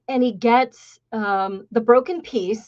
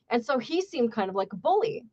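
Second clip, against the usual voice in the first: ordinary talk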